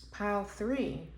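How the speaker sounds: ordinary talk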